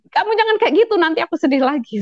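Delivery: high, quick, playful scolding of a crying friend; half-laughing reprimand, pitched high and fast; mock scolding. Scolding her crying friend, a mock angry high fast rebuke. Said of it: "saya tabok sedianya ini".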